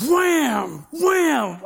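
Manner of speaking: big drawn-out "wham", high and loud; booming toy-crash noise, pitch falling; loud crash imitation. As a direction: smash it out like a car crashing. Friend smashing the toy car.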